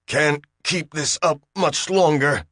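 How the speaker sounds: strained through gritted teeth. A narrator's reading. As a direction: force it out through clenched teeth. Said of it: "through gritted teeth".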